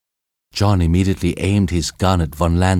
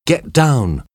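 urgent warning shout; 7 semitones higher than usual. A narrator's reading. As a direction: call out sharply. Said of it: "John shouted to the girls".

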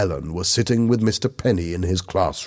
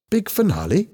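high, rising puzzled echo of big finale; questioning repeat, pitch up; surprised echo; 12 semitones higher than usual. A narrator's reading. Teacher echoes in surprise.

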